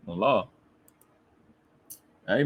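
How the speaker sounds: normal talking voice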